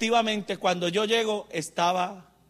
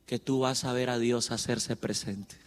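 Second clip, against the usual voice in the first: much lower and softer, almost confiding; quiet low promise; 7 semitones lower, 6 dB quieter than usual. Shown as a demonstration. Preacher confiding a promise to the faithful, low and soft. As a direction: murmur it low like a secret promise. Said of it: "Vuelvo y te lo digo".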